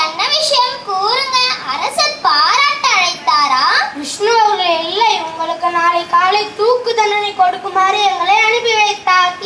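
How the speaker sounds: ordinary talk, nothing marked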